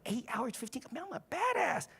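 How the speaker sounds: mock-boastful, rising high; cocky badass. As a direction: marvel at yourself in a high voice. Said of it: "I go".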